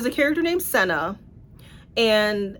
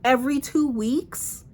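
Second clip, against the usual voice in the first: ordinary delivery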